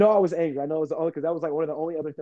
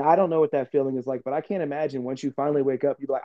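ordinary delivery

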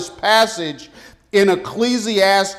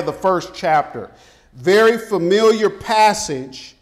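ordinary talk

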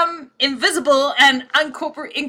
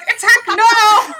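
high, loud and slow cry; mock battle cry; 12 semitones higher, 7 dB louder, slower than usual. Party charging the goblins, hammed-up shout. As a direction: bellow it like a panicked charge. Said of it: "we're like".

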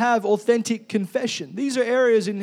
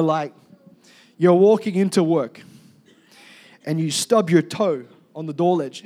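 ordinary talk

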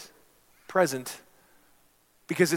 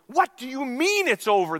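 higher, louder outraged demand; indignant bidder; 10 semitones higher, 7 dB louder than usual. Retold or imitated. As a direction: demand it in disbelief. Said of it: "that man from the back growled".

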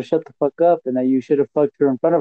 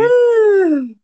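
surprised yelp; 5 dB louder than usual. Real, not acted. Friend hears the breakup news.

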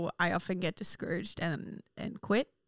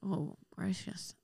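whispered; 10 dB quieter, slower than usual. Real, not acted. Caught off guard by question.

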